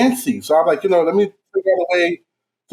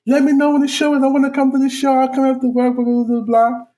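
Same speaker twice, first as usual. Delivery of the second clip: put-on higher, sweet voice of an eager girl; 8 semitones higher than usual. Imitating the shop girl who wants to come to the show. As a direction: chirp it like a girl asking him out.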